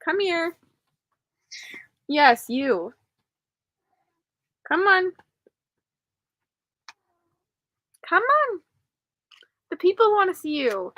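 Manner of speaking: high coaxing pet talk; sing-song call to the cat. Real, not acted. Calling the cat over.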